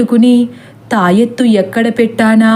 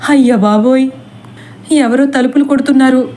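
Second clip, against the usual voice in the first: panicky; 3 semitones higher, faster than usual. Acted. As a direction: rush it out in alarm, a bit up in pitch. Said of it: "అంటుంది సీత గాబరా పడిపోతూ".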